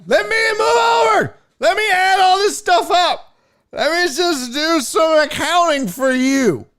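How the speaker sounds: high, loud, drawn-out slurred shout; imagined drunk heckler. Pretend. Drunk stranger takes over an accountant's job, slurred shouting. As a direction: holler it like a pushy, sloppy drunk. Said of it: "First of all, you're drunk".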